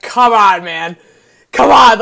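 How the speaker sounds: loud, delighted groan of disbelief; excited come on. Real, not acted. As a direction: shout it out, thrilled. Thrilled by Tiger's jacket line.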